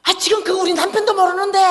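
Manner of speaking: high, loud panicky objection; angry protest. Retold or imitated. Friend whose husband doesn't know the secret, angry protest. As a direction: mimic a friend yelling back in protest. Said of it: "막 그러는 거예요".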